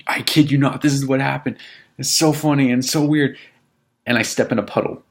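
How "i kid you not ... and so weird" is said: higher, louder, excited insistence; excited retelling. Retold or imitated. Telling girlfriend about the drunk intruder, higher and excited. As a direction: gush it like unbelievable news.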